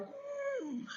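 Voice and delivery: soft, high-pitched anxious "mm"; high panicked whimper. Acted. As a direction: squeeze out a small inner squeal of worry. Inner panic behind cheerful front.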